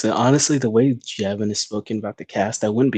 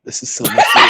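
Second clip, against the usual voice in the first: laughing shout; 5 dB louder than usual. Real, not acted. Laughing outburst at script.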